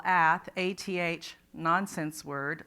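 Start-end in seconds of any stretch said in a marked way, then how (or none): 0.0-1.3 "ath" said alone then spelled A T H, slow; slow "ath", then the letters A, T, H; sounding out a syllable. Shown as a demonstration. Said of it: "read the word syllable by syllable".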